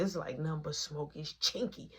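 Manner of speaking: ordinary talk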